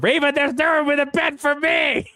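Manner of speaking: high put-on plea; whiny high voice. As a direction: whine it up high. Voicing a caption.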